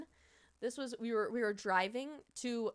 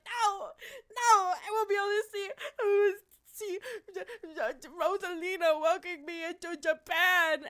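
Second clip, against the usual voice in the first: much higher, louder, fake-sobbing despair; mock crying; 8 semitones higher, 6 dB louder than usual. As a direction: wail like a crushed fan, hammed up. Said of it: "I almost crashed the fuck out because I was like".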